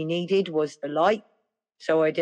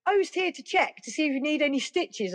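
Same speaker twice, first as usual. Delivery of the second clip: high mock doctor voice; 10 semitones higher than usual.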